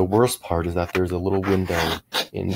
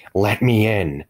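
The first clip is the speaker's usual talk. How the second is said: louder, hoarse monster demand; hoarse scream; 3 semitones higher, 5 dB louder, slower than usual. A narrator's reading. Monster pounding on the door to get in, hoarse scream. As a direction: rasp it out as a forceful cry. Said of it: "The whisper became a hoarse scream".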